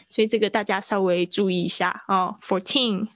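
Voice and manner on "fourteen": put-on "Fourteen" with strong "teen" and final n; stressed nasal ending. Shown as a demonstration. Teacher asking class to take note, end-stressed "Fourteen". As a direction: lean on "teen" in "Fourteen", finishing on the n. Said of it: "一个有鼻音".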